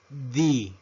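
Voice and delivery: letter D pronounced "dee"; English letter D. Shown as a demonstration. Teacher modelling the English alphabet, D as "dee". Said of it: "La letra D".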